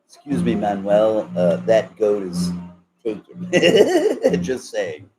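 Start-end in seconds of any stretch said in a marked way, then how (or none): 3.6-4.4 sudden high loud laugh; cracking up at his own joke; burst of laughter. Real, not acted. Laughing at his own goat joke, loud high laughter.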